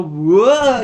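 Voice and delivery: hammed-up falling whoa; mock whoa. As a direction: yelp as you tumble down. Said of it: "You fucking go".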